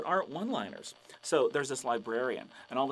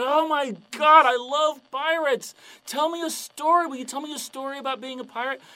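gushing fan; 10 semitones higher, 9 dB louder than usual. Acted. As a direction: squeal over meeting a real pirate. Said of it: "He's like".

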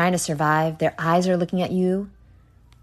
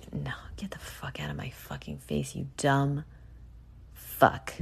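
hissed, much softer curse; disgusted whisper; 3 semitones lower, 10 dB quieter than usual. Pretend. Podcaster recoiling from imagined guts, whispered disgust. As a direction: spit it out under your breath in disgust. Cursing at imagined gross animal.

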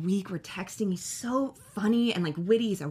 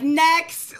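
mock dismissive call; 8 semitones higher, 8 dB louder than usual. Dismissing contestants.

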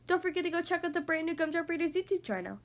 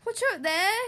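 louder, higher warning call; loud higher warning cry; Trevor calling out; 6 semitones higher, 5 dB louder than usual. Acted. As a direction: shout a warning to the pug. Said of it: "good Trevor did cry".

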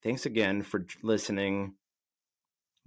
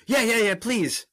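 excited; 13 semitones higher, 6 dB louder than usual. Real, not acted. Eager begging host.

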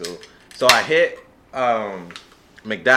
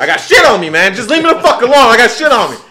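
much louder and higher, hammed-up panic; retold frantic yell; 9 semitones higher, 10 dB louder, faster than usual. Yelling at his girlfriend from the car seat, mock frantic shout. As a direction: bellow it like he's losing it.

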